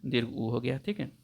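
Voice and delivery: usual speaking voice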